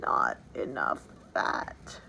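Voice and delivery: hushed, low, barely voiced; breathy whisper of a sick king; almost inaudible mutter. A narrator's reading. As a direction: mutter it so it can hardly be heard. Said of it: "He muttered almost inaudibly".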